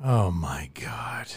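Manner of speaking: very slow, low whisper; appalled whisper. Real, not acted. Co-host reacting to a crude joke, appalled whisper.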